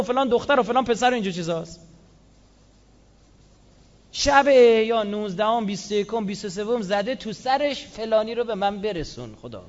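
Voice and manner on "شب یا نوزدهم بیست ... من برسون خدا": ordinary talk, nothing marked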